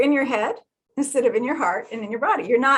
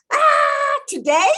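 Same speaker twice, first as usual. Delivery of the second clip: high, loud wail of disbelief; mock exasperated mother; 6 dB louder, much slower than usual. Imitating mother's high, loud wail about the overflow. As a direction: cry out like a frazzled mother.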